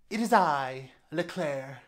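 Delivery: hammed-up French accent as Leclerc; grand announcement in a put-on French accent; mock French accent. Announcing herself to viewers as Leclerc, in a mock French accent. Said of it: "I don't know where that accent came from".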